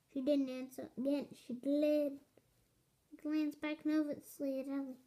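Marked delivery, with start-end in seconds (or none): none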